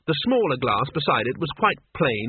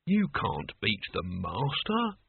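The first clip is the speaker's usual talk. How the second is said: put-on slow, softer old servant; weary old Albert; 3 semitones lower, 7 dB quieter, slower than usual. A narrator's reading. Albert warning Mort off fighting the master, slow and weary. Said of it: "He sighed".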